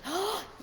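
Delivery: sharp intake of breath; excited gasp. Real, not acted. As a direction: gasp at a welcome answer. Reacts to deep conversation answer.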